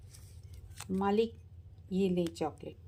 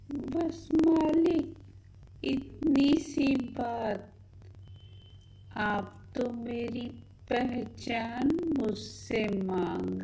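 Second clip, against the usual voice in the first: witch voice; much slower than usual. Acted. Witch slowly agreeing to change her voice for the girls. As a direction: stretch each word like an obliging spirit.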